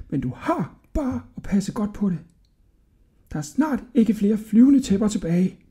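put-on high, hushed old man's voice; cautious old shopkeeper. A narrator's reading. El Fazer warning Hodja of eavesdroppers, hushed and high. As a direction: warn the boy confidentially, high and soft.

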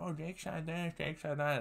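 lightly mocked whiny complaint; mock complaining. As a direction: imitate nagging family in a small high voice. Relatives complaining to his mom.

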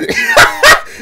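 high-pitched, loud cackling laugh. Real, not acted. Watcher laughing at a crazy move, high laugh.

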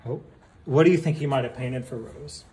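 usual speaking voice

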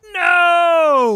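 high, long drawn-out shout; protesting yell. Real, not acted. Player upset that they cut that off, yelling. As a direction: wail it loud in protest.

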